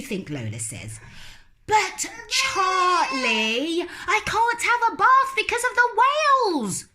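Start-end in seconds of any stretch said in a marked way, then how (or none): 1.7-6.8 put-on loud little girl. Lola protesting about whales, loud. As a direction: protest at the top of your voice.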